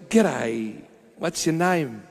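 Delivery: a light Aussie accent on a mock greeting. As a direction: greet her like a casual Aussie bloke. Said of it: "you need to be an Aussie".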